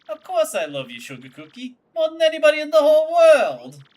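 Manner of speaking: hammed-up lover, rising loud at the end; a gangster swearing devotion; Frankie declaring love. Boyfriend declaring love, hammed-up.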